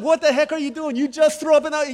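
high, shouted indignant demand; outraged confrontation. Retold or imitated. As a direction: explode at the culprit in comic outrage. Retold confrontation in hot tub.

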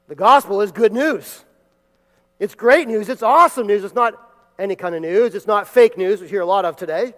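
preacher crying out the good news; preaching at full force. Acted. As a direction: proclaim the gospel as the greatest news.

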